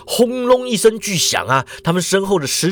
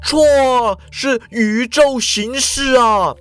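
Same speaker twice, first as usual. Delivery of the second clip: higher, slow correcting shout; lightly voiced, mildly raised correction; Ikeda calling out; 8 semitones higher, much slower than usual. A narrator's reading. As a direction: snap back no, then slowly. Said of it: "池田叫说".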